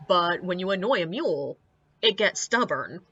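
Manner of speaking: normal talking voice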